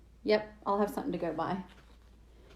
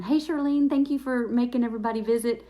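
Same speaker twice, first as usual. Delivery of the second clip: excited greeting; 4 semitones higher, 6 dB louder than usual. Real, not acted. Greeting live chat viewer.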